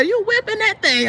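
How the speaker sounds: high, amazed cop voice; surprised question. Retold or imitated. Cop amazed a woman drives the Hummer, high and incredulous. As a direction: pitch it way up in disbelief. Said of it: "he said".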